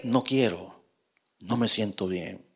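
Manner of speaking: mucho más suave y bajo, apagándose; abatido. Acted. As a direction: contesta hundido, casi sin voz. Respuesta desde la cueva. (abatido y muy suave)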